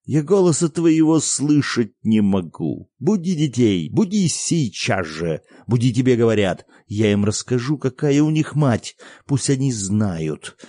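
put-on father yelling to wake the children; angry father. A narrator's reading. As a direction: rage at her, wake the whole house. Father raging at the mother.

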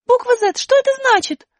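высоко и громко, недоумевая; маленькая героиня возмущённо спрашивает; высокий удивлённый голос. Acted. Аля спрашивает. (высокий удивлённый голос героини)

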